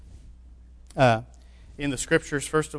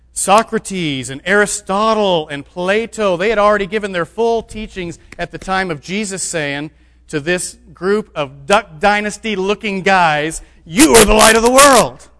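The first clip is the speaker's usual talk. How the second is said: higher and much louder, peaking on the quote; building to a shout; 4 semitones higher, 9 dB louder than usual. Real, not acted. Preacher to his congregation, building into a full shout. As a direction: climb until you're shouting the verse.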